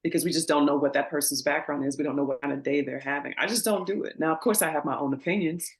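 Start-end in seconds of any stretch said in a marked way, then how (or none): none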